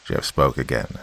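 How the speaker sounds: ordinary delivery, nothing marked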